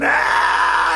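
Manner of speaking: high unvoiced scream of falling bones; breathy bone scream. A narrator's reading. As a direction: rasp out a ghostly scream. (grito susurrado de los huesos)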